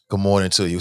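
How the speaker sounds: ordinary delivery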